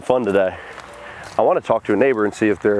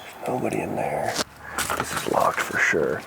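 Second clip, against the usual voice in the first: whispering; 8 semitones lower, 8 dB quieter than usual. Real, not acted. Checking rooms quietly.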